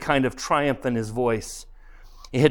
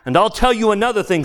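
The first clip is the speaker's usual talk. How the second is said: higher, louder and quick, indignant; angry retort; 8 semitones higher, 5 dB louder, faster than usual. Acted. Ghost turns on the murderer, angry retort. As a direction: round on him heatedly.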